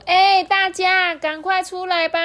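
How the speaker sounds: high, drawn-out call to friends in the forest. Acted. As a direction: call the gang out of the woods. 还对着森林喊说.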